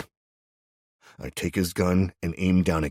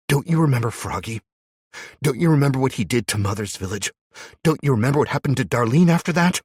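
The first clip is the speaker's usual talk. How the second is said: bitter reminder; 9 semitones higher, 7 dB louder than usual. Acted. Abby reminding Jack of past.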